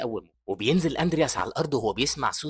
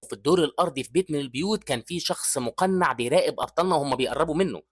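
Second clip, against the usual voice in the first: ordinary delivery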